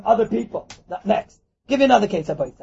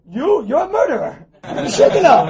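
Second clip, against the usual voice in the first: raised, slow accusation; indignant rebuke; 6 semitones higher, 5 dB louder, slower than usual. Acted. Scolding the one who shamed a thief, indignant. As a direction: lay into him, drawing out the words.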